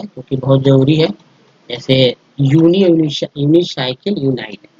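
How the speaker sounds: ordinary talk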